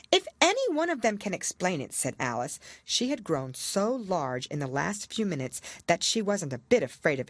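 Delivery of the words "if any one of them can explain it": put-on higher, bolder girl's voice. A narrator's reading. Alice contradicting the queen, indignant. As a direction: object firmly as a young girl.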